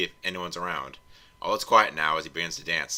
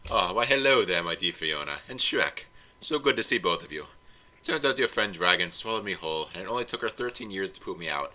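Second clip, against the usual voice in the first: put-on a light Scottish accent on the smug villain; Scottish Farquaad. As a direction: greet them in a mild Scottish lilt, all smug. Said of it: "Every voice I do defaults to Scottish".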